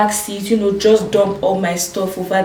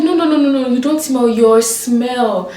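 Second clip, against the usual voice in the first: mimicking driver; 4 semitones higher, 3 dB louder than usual. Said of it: "He now said".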